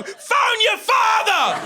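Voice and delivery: high, drawn-out yell; mimicked mum shrieking an order; all-caps mum. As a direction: bellow it slowly like an all-caps text. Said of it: "accidentally set her text messages to all caps".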